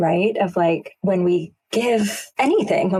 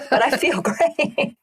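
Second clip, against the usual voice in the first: said through real laughter, higher; laughing at herself, a little slower; 9 semitones higher, slower than usual. Real, not acted. Tells husband about the crystal therapy, laughing.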